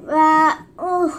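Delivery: usual speaking voice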